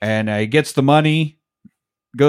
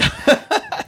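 high unvoiced laugh. Real, not acted. Laughing at the dove joke.

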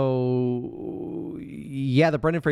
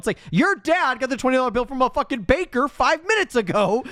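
exasperated rant; 4 dB louder than usual. Real, not acted. Ranting at the character.

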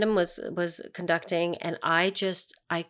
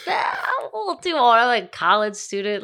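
mock whiny; 5 semitones higher, 5 dB louder than usual. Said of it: "it's like".

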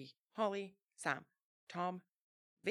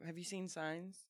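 ordinary talk, nothing marked

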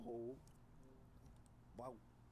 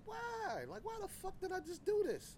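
much higher, anguished why; regretful outcry; 4 dB louder than usual. Retold or imitated. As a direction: cry out in self-reproach, pitched way up. Voicing his own regret afterwards.

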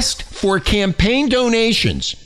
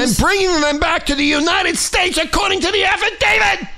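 high, climbing shout; indignant yelling; 8 semitones higher than usual. Real, not acted. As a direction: build to an outraged finish. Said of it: "I'm sorry I'm yelling".

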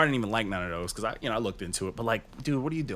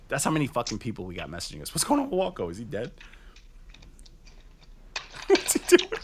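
ordinary talk, nothing marked

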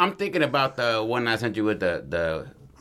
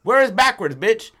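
high, loud, exasperated yelling; frustrated shout; 11 semitones higher, 4 dB louder than usual. Retold or imitated. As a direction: yell it like you're fed up and lost. Friend voiced, frustrated while lost.

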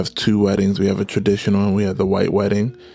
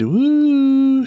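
excited cheer; 4 dB louder than usual. Real, not acted. Celebrating best friend's wedding.

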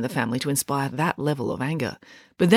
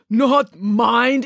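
put-on incredulous, higher Russian-accented cry; exclaiming Russian accent; 7 semitones higher, 4 dB louder than usual. A narrator's reading. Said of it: "exclaimed Mister Popov".

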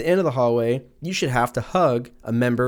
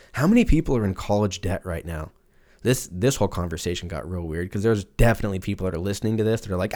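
ordinary delivery

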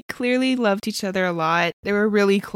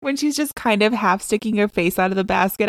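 ordinary talk, nothing marked

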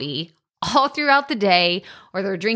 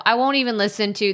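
normal talking voice

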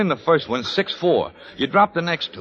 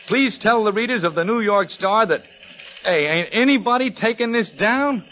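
rising exasperation; 9 semitones higher than usual. Acted. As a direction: pitch climbing higher to grab attention. Reporter tries to get attention.

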